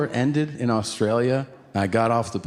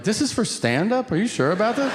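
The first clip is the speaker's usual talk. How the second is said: mock disbelief; 8 semitones higher than usual. Disbelief at lavish treatment.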